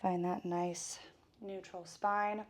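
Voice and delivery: loud, fast workout coach; fitness instructor. Acted. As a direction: pump up the class. Leads a workout warm-up.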